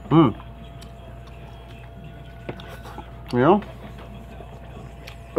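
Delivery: biting into chicken; chewing while talking. Real, not acted. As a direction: mouth partly full. Seen 0: Holds chicken at mouth, bites at 2.1–2.6, chews after.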